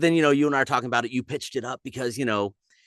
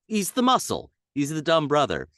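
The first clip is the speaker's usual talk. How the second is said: usual speaking voice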